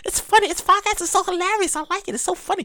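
high, hushed imitation of a fan; gushing fan. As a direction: mimic a listener passing on praise, small high voice. Mimics fan recommending the podcast.

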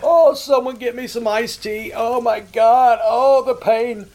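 hammed-up wounded whining, slow and loud; mock hurt. Prankster mocking insulted callers, hammed-up fake anguish. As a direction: sarcastically pretend to be deeply wounded. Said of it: "madder and madder and go".

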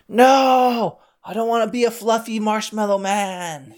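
put-on high, frightened kid protest; bully crying out in fear as he turns into a marshmallow; scared cry. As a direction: panic at turning into a marshmallow. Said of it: "he was getting scared".

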